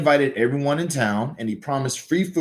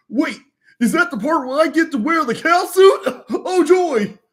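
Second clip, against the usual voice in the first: much higher, dopey sidekick; eager sidekick voice; 6 dB louder than usual. Acted. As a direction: chirp it like a goofy eager helper. Sidekick excited about cow suit.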